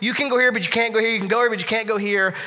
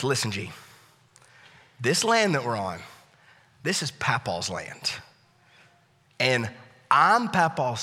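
reassuring; 6 semitones lower, 5 dB quieter than usual. Retold or imitated. Father calming frightened son.

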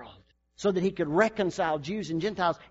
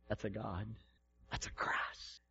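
softer, whispered wonder; awed whisper; 8 semitones lower, 14 dB quieter than usual. Retold or imitated. Preacher voicing awed onlookers, whispering. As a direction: breathe it like a secret.

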